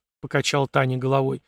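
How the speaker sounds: plain reading voice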